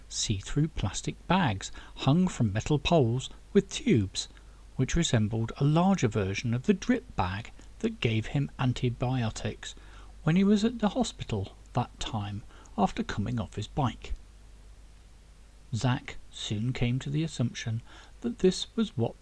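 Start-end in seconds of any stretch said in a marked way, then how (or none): none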